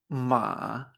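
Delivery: MA en tercer tono, grave y alargado. Shown as a demonstration. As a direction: baja MA al fondo de la voz. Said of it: "había cuatro tonos y un tono neutro". («ma» en tono bajo y sostenido)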